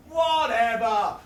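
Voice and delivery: long drawn-out, falling "whatever"; big slow shrug of a word; dismissive refrain. Acted. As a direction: stretch both halves slowly and let it drop. Repeated refrain of performance poem.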